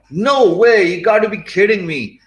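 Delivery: loud, high-pitched astonishment; imitated incredulous outburst; shocked disbelief. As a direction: act out a stunned client. Acts out shocked client's reaction.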